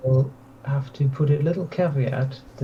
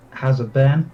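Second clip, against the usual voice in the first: ordinary talk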